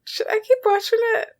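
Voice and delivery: put-on high, bright sing-song girl voice; bubbly sorority girl. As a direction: chirp it like a bubbly teen.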